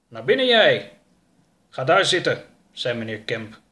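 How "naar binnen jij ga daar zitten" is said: loud, raised order; stern command. Acted. Kemp to his captive at the house, loud command. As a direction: order the captive inside, firmly.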